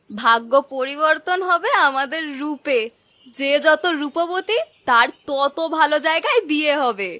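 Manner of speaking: ordinary talk, nothing marked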